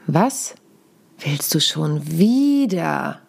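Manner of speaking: Was, pause, then long WIEDER with a falling end; slow, weighty "wieder" at the end; stress on wieder. Shown as a demonstration. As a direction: stretch and stress wieder slowly. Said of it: "die Betonung einzelner Worte verändern".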